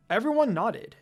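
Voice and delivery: usual speaking voice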